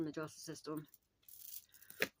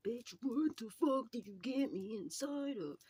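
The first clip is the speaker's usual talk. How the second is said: outraged demon; 7 semitones higher, 4 dB louder, slower than usual. Retold or imitated. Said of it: "this is even the demon that's inside of her".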